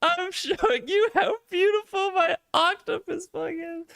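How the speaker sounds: high squeaky mock echo, laughing through it; mocking imitation. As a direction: parrot him back in a silly high voice while cracking up. Reactor mimics clip line, laughing.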